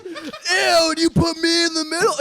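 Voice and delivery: high, loud mock revulsion; disgusted outrage. Brooke learning why she's beside him, loudly disgusted. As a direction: imitate a grossed-out, indignant passenger.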